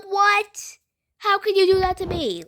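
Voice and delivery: high, loud what; outraged disbelief. Retold or imitated. Suspended teen protesting to the principal, high and loud. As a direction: shriek it like it's the end of the world. Told she's suspended a month.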